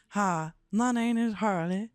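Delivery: put-on drawn-out Southern belle accent; a puppet Southern belle introducing herself. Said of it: "She's a Southern belle".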